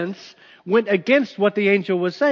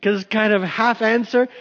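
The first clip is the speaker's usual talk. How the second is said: normal talking voice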